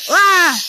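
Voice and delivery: loud sharp yelp; startled scream. Pretend. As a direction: yelp in fright.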